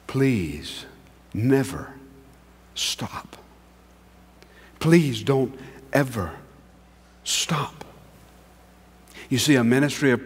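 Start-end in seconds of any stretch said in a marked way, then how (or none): 0.1-7.8 hushed plea. Acted. Pastor pleading with the congregation.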